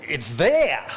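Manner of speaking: lightly mimicked French professor's accent; Jean-Jacques Lafont. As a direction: echo the professor's mock French reply. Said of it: "like Jean-Jacques, I turn to our report".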